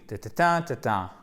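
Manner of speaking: "Ta-ta-ta, ta-ta" as a stress pattern; rythme scandé. Shown as a demonstration. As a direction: beat out the word's rhythm on "Ta-ta-ta, ta-ta". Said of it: "Il y a vraiment un rythme". (Ta-ta-ta, ta-ta, rythme de l'accent tonique scandé)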